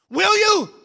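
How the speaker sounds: sudden high, loud challenge; shouted plea to the congregation; dramatic loud question. Shown as a demonstration. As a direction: burst out with the question. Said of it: "I would like to dramatically say".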